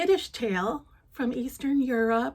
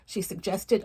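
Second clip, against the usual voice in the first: ordinary delivery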